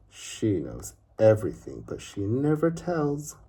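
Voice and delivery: plain reading voice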